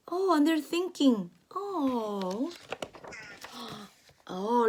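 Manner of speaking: plain reading voice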